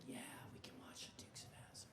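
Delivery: hushed whisper of permission. Retold or imitated. Mother whispering her yes to the kids. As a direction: breathe it out secretly. Mother quietly allows forbidden TV.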